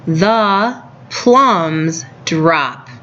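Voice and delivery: very slow, word-by-word reading aloud; reading to a toddler. Shown as a demonstration. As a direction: sound out each word slowly for young kids. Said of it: "And the bottom sentence".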